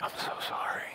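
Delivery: barely whispered, heavy with sorrow; hushed, broken-hearted messenger; grief-struck apology. Acted. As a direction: whisper it as if you can hardly say it. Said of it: "he can't even make eye contact".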